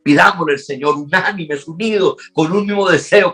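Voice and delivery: full-force Spanish pulpit preaching; impassioned preaching. Acted. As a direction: preach it with rising fervour over the music. Preacher builds to climax over music.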